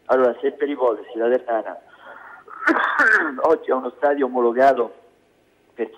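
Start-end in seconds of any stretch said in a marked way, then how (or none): 2.5-3.4 chesty cough. Real, not acted. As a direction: cough from the chest mid-sentence. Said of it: "con sta tosse che c'hai".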